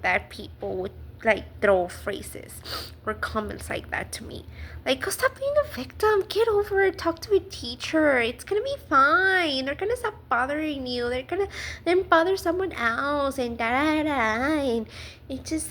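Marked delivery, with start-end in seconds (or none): 5.0-14.1 higher-pitched singsong mimicry of platitudes; imitating glib, brush-off advice; mock dismissive advice. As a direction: rattle off their empty reassurances mockingly. Mimics phrases people throw at her.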